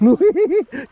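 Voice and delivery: high amused laugh. Real, not acted. Angler laughing that the fish became his, high laugh.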